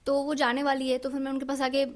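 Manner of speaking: normal talking voice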